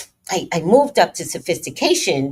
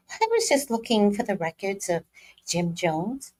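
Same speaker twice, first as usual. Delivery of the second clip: a higher, prim office phone voice; corporate phone voice; 7 semitones higher than usual. Retold or imitated.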